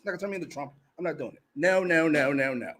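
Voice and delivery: ordinary delivery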